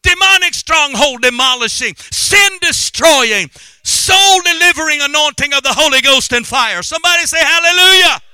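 high, shouted revival preaching; fiery declarations building to a hallelujah shout; full-force preaching. Real, not acted. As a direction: thunder it out to the congregation.

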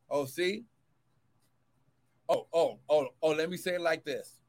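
ordinary talk

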